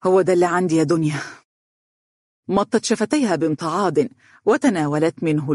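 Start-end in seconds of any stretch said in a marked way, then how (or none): 0.0-1.2 a hurried, hopeless admission; mild despair, giving up. Acted. Young man tells Donia it's all he has, despairing. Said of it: "وقال بيأس".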